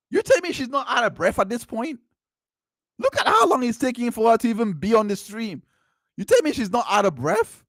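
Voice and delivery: high-pitched, indignant disbelief; incredulous. Real, not acted. Commentator challenges her denial to viewers, incredulous. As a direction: push the voice right up in disbelief.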